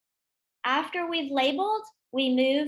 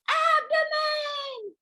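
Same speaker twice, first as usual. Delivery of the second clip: very high, slow, shouted abdomen full of joy; like you won the lottery; 13 semitones higher, much slower than usual. Shown as a demonstration. Teacher showing the class a lottery-winner abdomen, high and shouted. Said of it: "Say it like you won the lottery".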